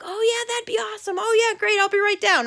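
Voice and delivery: high-pitched eager acceptance; excited. Retold or imitated. Girl upstairs agreeing to hang out, excited. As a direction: imitate a thrilled girl, voice lifted throughout. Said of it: "she's all excited about hanging out".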